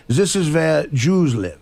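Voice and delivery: put-on slow German-accented tour guide. German guide showing a tourist places, slow German accent. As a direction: imitate a German guide, spacing the words out. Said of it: "that German accent is just really".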